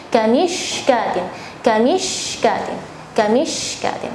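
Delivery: kanishkatin slow, sh clearly spread; over enunciating. Shown as a demonstration. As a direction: kanishkatin read out carefully, syllable by syllable. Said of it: "saya baca lagi".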